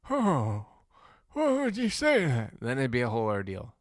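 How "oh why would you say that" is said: put-on high, loud wounded old-man wail; hammed-up shocked elder; offended old man. Old man reacting to my insult, high hurt protest. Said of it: "But he would have gone".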